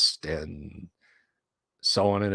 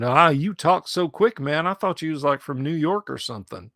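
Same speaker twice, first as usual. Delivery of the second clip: lightly voiced Alabama local, a bit higher; imitated local fellow; 5 semitones higher, 3 dB louder than usual. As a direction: imitate a surprised Southern acquaintance. Retold cousin's friend explaining his surprise.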